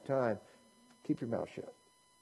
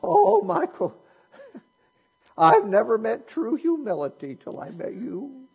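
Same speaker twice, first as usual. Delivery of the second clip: much higher and louder, swooning "Oh, Michael" with a laugh; gushing lady impression; 9 semitones higher, 7 dB louder than usual. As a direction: ham up the adoring college lady. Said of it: "that's the way she talked".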